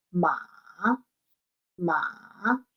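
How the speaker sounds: long MA dipping down, then up; third tone. Shown as a demonstration. Teacher demonstrating the third tone, long dipping "ma". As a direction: stretch "ma" like a long question, falling then rising. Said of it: "es como una pregunta larga".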